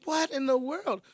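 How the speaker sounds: a hammed-up what-in-the-world; mock disbelief. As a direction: act stunned at the news. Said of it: "I'm like".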